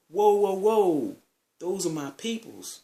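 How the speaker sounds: high, drawn-out, loud whoas easing off; protesting interjection. Retold or imitated. Friend defends his guests to angry host, protesting.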